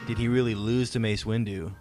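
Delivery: ordinary talk, nothing marked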